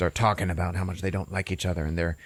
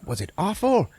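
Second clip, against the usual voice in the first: high, swooping question; high-pitched scandalized concern; Gefilte Fish; 11 semitones higher than usual. Retold or imitated. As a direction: ask high with mock horror. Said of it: "Gefilte Fish is".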